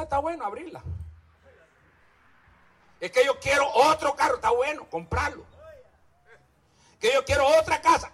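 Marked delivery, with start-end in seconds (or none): 3.0-4.4 mock whiny believer. Mocking greedy believer's request. 7.0-8.1 mock whiny believer. Mocking greedy believer's request.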